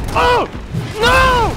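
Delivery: panicked high outburst; alarmed yell. Real, not acted. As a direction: shout as danger hits. Dodging the boss's attack.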